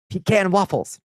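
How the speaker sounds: high, eager craving; light up at the food; excited. Real, not acted. Craving waffles.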